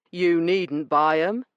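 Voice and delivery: a smug weasel of a man brushing her off; rough dealer. Acted. Branson dismissing Io's complaint, smug and rough.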